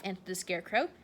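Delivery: ordinary delivery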